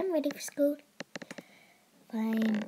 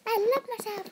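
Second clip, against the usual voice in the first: usual speaking voice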